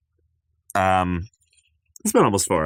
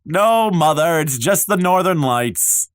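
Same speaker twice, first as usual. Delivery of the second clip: higher, mildly raised reply; calling back; 5 semitones higher than usual. Acted. Son reassuring mother about the fire, calling back. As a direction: call it back to another room.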